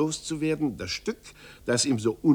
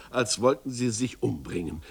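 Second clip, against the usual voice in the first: plain reading voice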